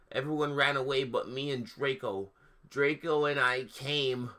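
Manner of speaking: hammed-up reading of bad fan fiction; mock-earnest story narration; mock dramatic reading. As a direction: read it like a deadpan goth diary. Reads the fan fiction aloud.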